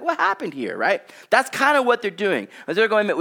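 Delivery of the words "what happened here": mock amazement. Amazed at the cleaned-up road.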